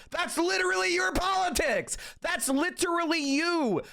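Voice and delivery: high, exasperated accusation; mocking outrage. Real, not acted. Streamer mocking Destiny's politics, high and exasperated. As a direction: hit literally hard and high.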